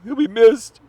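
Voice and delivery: high, loud wailing fake grief; mock sobbing. Saying goodbye to a dead hater, loud mock wail. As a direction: blubber it out in mock despair. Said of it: "These aren't crocodile tears".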